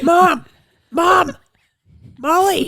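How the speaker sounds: loud high shout of Mom; calling out. Real, not acted. Sister calls loudly for mom and Molly, shouting. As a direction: yell for her across the house.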